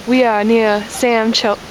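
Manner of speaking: lightly drawled southern accent attempt; slow southern drawl, half-hearted. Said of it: "Today we are talking in a southern accent".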